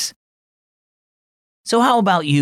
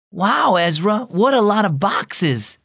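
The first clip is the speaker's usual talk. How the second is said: put-on higher-pitched boyish wonder, lightly done; mild exclamation; 6 semitones higher than usual. A narrator's reading. Boy looking round best friend's packed room, mildly exclaiming. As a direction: exclaim with a touch of amazement. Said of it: "Jackson exclaimed as he looked around".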